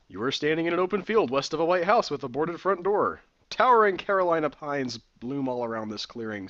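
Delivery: steady adventure narrator read; reading the room description aloud; game text narration. Acted. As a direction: set the scene as the game narrator.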